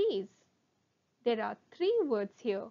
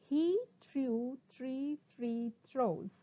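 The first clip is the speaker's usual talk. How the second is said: very slow, word by word; slow tongue twister; much slower than usual. Shown as a demonstration. Teacher introduces a twister to students, very slowly. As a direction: draw out every word. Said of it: "first try saying them slowly".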